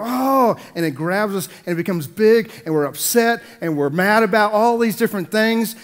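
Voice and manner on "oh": high loud groan of annoyance; exasperated oh. Retold or imitated. Upset people reacting to small things, exasperated. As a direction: sound fed up. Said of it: "we go".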